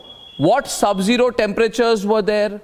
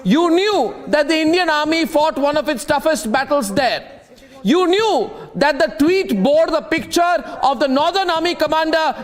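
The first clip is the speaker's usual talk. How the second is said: indignant scolding; 5 semitones higher than usual. Real, not acted. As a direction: hammer it home like an outraged accuser. Scolding opponents over disrespect to army.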